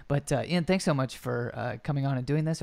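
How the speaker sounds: ordinary talk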